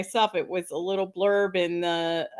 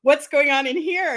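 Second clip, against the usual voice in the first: higher, louder imitated question; nosy intruder; 7 semitones higher, 6 dB louder than usual. Band member bursts into women's bathroom, loudly curious. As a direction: imitate a guy cheerfully barging in.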